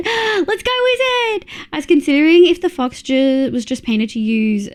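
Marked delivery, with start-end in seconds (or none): 0.1-1.5 excited cheer. Real, not acted. Cheering a viewer drawing again.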